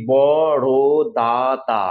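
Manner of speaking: বড় দা, দা drawn out as bo-ro-da-da; syllable by syllable. Shown as a demonstration. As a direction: give each beat of বড় দা, দা its own space. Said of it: "চোখ বুজে উচ্চারণ করি".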